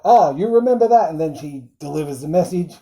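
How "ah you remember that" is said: higher and louder mock-pleased count; a lightly voiced Dracula, pleased; mock Dracula delight. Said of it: "Dracula's like".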